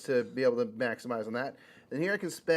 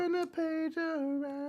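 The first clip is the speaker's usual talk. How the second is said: high voice; slower than usual. Pretend. High mock repeat.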